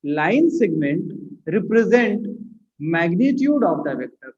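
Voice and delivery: very slow, phrase by phrase with gaps; measured words for note-takers; slow dictation. Real, not acted. Teacher writing the rule for class, slow dictation.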